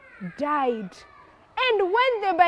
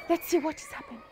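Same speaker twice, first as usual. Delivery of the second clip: hushed crowd; 11 dB quieter, much faster than usual. Acted. Onlookers waiting for the dust to clear, hushed. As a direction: keep it very soft, waiting to see. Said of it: "everyone is keeping quiet".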